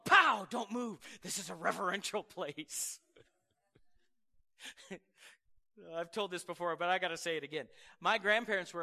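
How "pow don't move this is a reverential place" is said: stern mom hushing. Retold or imitated. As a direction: hush a fidgeting kid like a strict mother. Mom hushing child in church.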